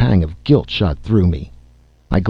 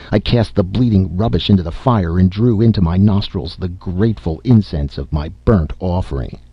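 ordinary delivery, nothing marked

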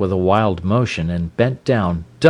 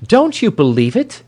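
very high-pitched exclamation; high, incredulous woman's cry; 14 semitones higher, 4 dB louder than usual. A narrator's reading. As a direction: cry out in scornful disbelief. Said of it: "she cried".

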